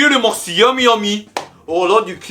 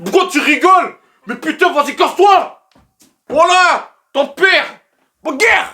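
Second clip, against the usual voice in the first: mock yelling; 9 semitones higher than usual. Jojo yelling at Tigru for laughing. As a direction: shout at the pet in character.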